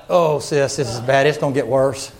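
low mock-worried churchgoer; hammed-up deep-pitched grumble; muttered aside. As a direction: drop the pitch right down, confiding doom. Acts out a disapproving listener's aside.

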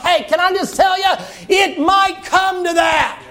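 fervent slow shouted exhortation; preaching at full force. Acted. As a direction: drive it home to the congregation.

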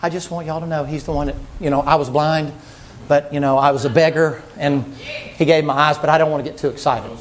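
low subdued mock-dignified testimony; restrained Bartimaeus. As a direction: keep the excitement bottled up. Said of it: "Bartimaeus was probably saying".

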